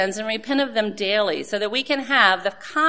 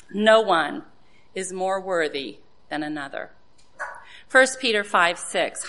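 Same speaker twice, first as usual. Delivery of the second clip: ordinary talk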